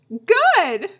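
high, loud fake-cheery "good"; mock cheeriness. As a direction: imitate a too-perky small-talk answer. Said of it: "bullshitting when we're like".

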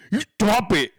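loud, stretched-out cartoonish threat; hammed-up angry warning; mock threat from critics. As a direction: mimic an outraged critic, dragging out the words. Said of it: "That's what they tell me".

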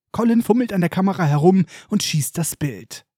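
ordinary delivery

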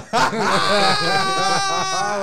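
a real, loud, high shrieking laugh; howling with laughter at the punchline. Real, not acted. Explodes laughing after Bye Felicia.

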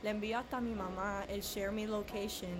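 normal talking voice

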